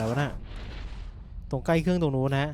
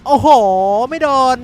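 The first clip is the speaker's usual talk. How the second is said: louder, higher frustrated cry of a miss; excited outburst; 5 semitones higher, 9 dB louder than usual. Real, not acted. Player missing a hit in the chase, loud excited cry. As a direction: blurt it out as the swing misses. Missed hit while chasing.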